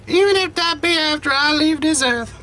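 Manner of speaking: put-on a frail old grandmother, tender and teary; grandma voice. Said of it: "only one I can go for ... old elderly lady".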